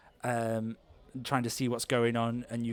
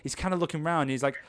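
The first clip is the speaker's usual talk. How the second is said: ordinary delivery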